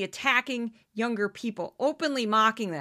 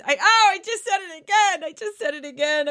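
hammed-up panic, loud and high on oh, then easing off; mock horror at slipping up; 6 semitones higher, 7 dB louder than usual. Grad student who said guys, in hammed-up panic. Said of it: "I wasn't that dramatic".

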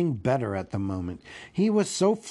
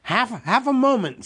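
high, loud, stammered plea; shivering; 10 semitones higher, 6 dB louder than usual. Acted. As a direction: stammer it like a frightened hobbit.

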